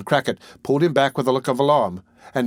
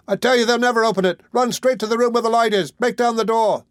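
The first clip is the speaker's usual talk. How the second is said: put-on boy screaming; 11 semitones higher than usual. A narrator's reading. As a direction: hurry the crowd in with your scream. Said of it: "screamed the boy".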